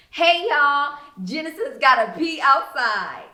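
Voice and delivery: loud teasing shout to the group; mocking call-out. A narrator's reading. Regina taunts Genesis in front of the girls, loud mocking call. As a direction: holler the joke so everyone hears.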